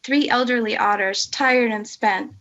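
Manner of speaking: measured, rhythmic reading of rhyming verse; poem recitation. Acted. Reading the English translation of an otter poem to colleagues, in a rhythmic recitation.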